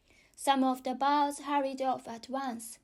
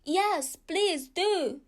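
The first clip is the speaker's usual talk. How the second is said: chorus of pleading; 6 semitones higher, 4 dB louder, much slower than usual. Acted. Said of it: "joined in a chorus".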